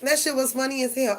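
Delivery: ordinary talk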